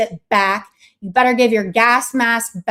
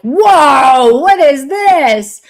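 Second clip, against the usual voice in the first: loud, higher, slow mock gasp; mock surprise; 4 semitones higher, 8 dB louder, much slower than usual. Host imitating strangers finding junk, loud amazed mock surprise. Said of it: "they're like".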